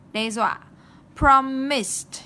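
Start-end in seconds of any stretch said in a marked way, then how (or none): 1.2-2.2 "Promised" loud, ending in a crisp st; ED after S. Shown as a demonstration. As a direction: finish "Promised" on s plus t. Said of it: "Kết thúc bằng âm sờ".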